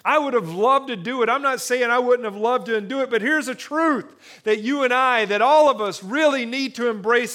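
loud, high, rousing sermon build; fervent pulpit crescendo; preaching at full force. Acted. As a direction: preach it, lift the room.

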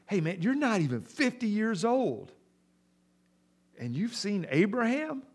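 high, disbelieving jeer; high mocking disbelief; incredulous scoff. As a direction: laugh him off in disbelief.

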